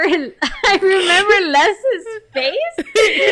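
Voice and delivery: words breaking into real laughter; can't get it out for laughing; laughing while talking. Real, not acted. Telling a friend the story, real laughter. Remembering the scene, cracking up.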